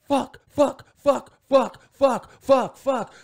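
loud repeated high shouts of fuck; Tourette's outburst. Retold or imitated. Girl with Tourette's shouting at the cashier, loud high bursts.

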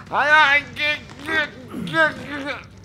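high, frightened stammer fading away; panicked. Real, not acted. Player panicking after going in on three, high stammer.

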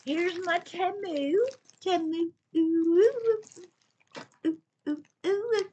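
very high, stretched-out squeal of delight; playful singsong. Real, not acted. Vlogger teasing her Temu haul, playful high singsong. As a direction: sing it out high with gleeful oohs.

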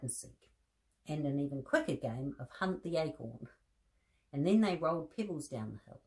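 gentle storybook reading with voiced bears; reading to children. A narrator's reading. Presenter reading a teddy bear story to children, gentle storybook voice. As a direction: read the story aloud to little listeners. Reads picture book to children.

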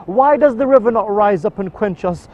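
spoken-word mourning poem in the voice of Imam Hussein; grieving poem recitation. Acted. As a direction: recite a lament for Karbala with mounting grief.

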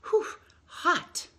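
hot puffing sheep. Acted.

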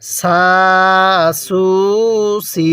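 সা, সু, সি with light s, vowels drawn out; three separate long syllables on a thin s; thin sin. Shown as a demonstration. Teacher shows learners the sin sound, thin and long. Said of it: "আমি সিনটা কিন্তু চিকন করে উচ্চারণ করছি".

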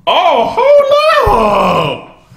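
loud, high, very slow drawn-out cry; outraged hold up. Real, not acted. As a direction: stretch it out in a loud, high holler. Reacting to an outrageous lyric.

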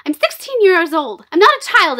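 mock a sulky teenager insisting she's grown; imitating Ariel. Ariel in The Little Mermaid arguing with her father, mock whiny. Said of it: "when Ariel says".

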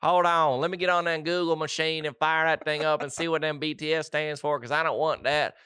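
a Southern father grumbling about his daughter's band; Southern dad accent. Pretend. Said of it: "We've done a Southern accent".

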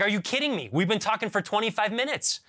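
you can't believe what he just told you; exasperated disbelief. Retold or imitated. Caller to customer service agent, incredulous and fed up. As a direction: pitch jumps high in outraged disbelief. Disbelief after long support call.

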